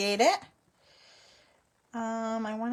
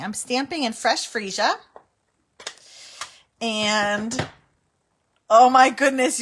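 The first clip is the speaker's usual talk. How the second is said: normal talking voice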